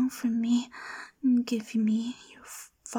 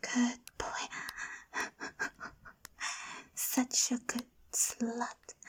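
ordinary talk, nothing marked

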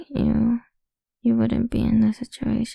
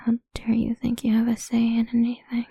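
ordinary talk, nothing marked

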